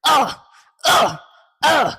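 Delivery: loud, high effort grunt; aggressive grunt. Shown as a demonstration. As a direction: grunt hard like taking a hit. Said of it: "That might be good".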